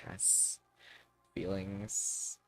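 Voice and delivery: normal talking voice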